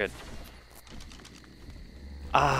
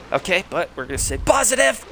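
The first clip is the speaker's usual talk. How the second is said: rattled forced cheer; 8 semitones higher, 6 dB louder than usual. Real, not acted. Nearly killed by level twenty.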